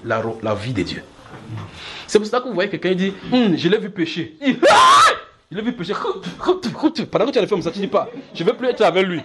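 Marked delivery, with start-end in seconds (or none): 3.3-5.9 high shriek, loud with laughter; gossip's shocked exclaim. Retold or imitated. Church gossip spotting a sin, shocked shriek.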